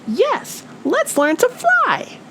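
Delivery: a tiny bird squeaking with excitement; Fluff the bird. A narrator's reading. Fluff urging her friends, high bird voice. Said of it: "Fluff said".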